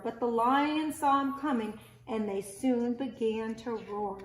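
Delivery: gentle storybook read-aloud voice; reading to children. Acted. Teacher reading a picture book aloud to children.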